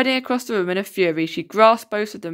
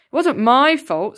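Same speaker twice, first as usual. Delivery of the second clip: put-on slow, loud and higher angry boy; boy defending himself to the teacher; snarled; 6 semitones higher, 5 dB louder, slower than usual. A narrator's reading. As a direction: spit it back in anger. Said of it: "snarled Rohan".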